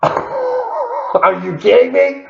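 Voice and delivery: laughing, drawn-out, high squeal of delight; thrilled by the strawberry shortcake, slow and high; delighted disbelief. Real, not acted. Delighted by the dessert.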